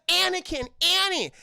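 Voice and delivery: high, calling imitation of a woman; worried Padme calling. Streamer imitates Padme running over to Anakin, calling out.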